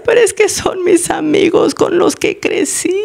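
put-on high, slow pleading child voice; childlike voice. Slave pleads for friends he grew up with, childlike. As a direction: imitate a helpless child objecting. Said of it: "el otro responde como un niño".

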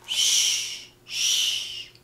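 very soft, drawn-out "shh"; hushing. Acted. Grandma hushes for sleeping bunnies, soft shushing. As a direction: hush gently, twice.

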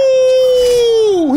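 high, loud whooping cheer; excited shout. Real, not acted. Cheering at arrow scene.

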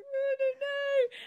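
high, drawn-out imitation of own younger self; mock helpless reply. Guest replaying their answer to teasing schoolkids, mock helpless squeal. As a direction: squeal it slowly in a put-on high voice. Retelling childhood reply about accent.

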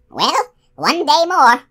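high, loud, slow crone's cackling sigh; an old witch sighing through a cackle. A narrator's reading. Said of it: "she said in a cackling sigh".

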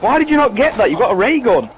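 loud, incredulous complaint; exasperated yell. Real, not acted. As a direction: start high and berate the teammate. Berating a teammate for missing.

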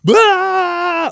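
high pained yell; mock scream of pain; imitated man screaming. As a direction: belt out a grown man's scream. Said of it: "I hear".

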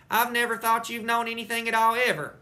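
high, loud teasing shout through cupped hands. Acted. Junie B shouting to Sheldon through cupped mitts, loud tease. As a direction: shout it so the whole yard hears. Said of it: "I shouted".

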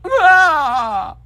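high, loud cartoon wail; long high-pitched mock cry. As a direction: wail it out in hammed-up despair. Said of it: "And he's like".

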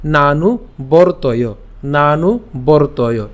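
slow নানু বর্তয় with a long oo ending নানু; measured teaching demo of নানু বর্তয়; careful pronunciation. Shown as a demonstration. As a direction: draw out নানু, then বর্তয় as bor-toyo. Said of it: "উচ্চারণটা কেমন হবে".

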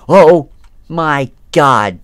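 slow, loud, high gasped words; real alarm; shocked. Real, not acted. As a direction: drag it out in shock. Shock at creature.

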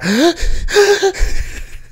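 a mock gasp for air, high; sobbing gasp. Showing how hard he cried, a high gasp. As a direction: act out being unable to breathe. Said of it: "mae, no podía respirar".

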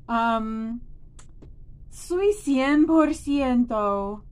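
ordinary delivery

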